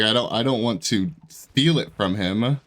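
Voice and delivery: normal talking voice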